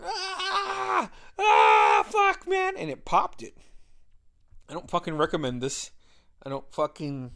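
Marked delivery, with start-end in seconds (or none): 0.0-2.8 high, very loud yell of pain; screamed cry, then loud cursing. Retold or imitated. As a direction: scream as your back gets jumped on. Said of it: "I just started screaming".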